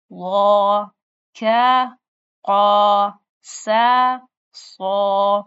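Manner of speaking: heavy, full letter sounds. Shown as a demonstration. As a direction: thicken each letter with the back of the tongue. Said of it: "یہ موٹا پڑھا جا رہا ہے".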